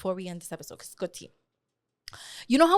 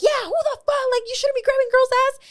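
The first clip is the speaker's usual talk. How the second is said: much higher, fast outraged friend; indignant friend; 13 semitones higher, 3 dB louder than usual. Retold or imitated. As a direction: squeak it out like the offended friend. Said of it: "my friend was like".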